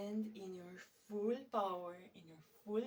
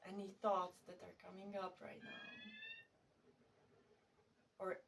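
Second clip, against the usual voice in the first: guided meditation; 6 dB quieter than usual. Real, not acted. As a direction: settle into soft, unhurried guiding, letting phrases hang.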